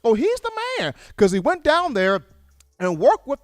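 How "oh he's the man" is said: much higher, mock-gushing praise; suddenly impressed fans. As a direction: rave about him, pitch climbing high. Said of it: "got everybody saying".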